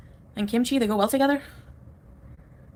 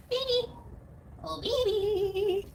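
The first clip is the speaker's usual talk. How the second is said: slow, much higher, softer coo; 11 semitones higher, 7 dB quieter, much slower than usual. Real, not acted. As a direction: coo tenderly at the animal. Cooing at pet on video.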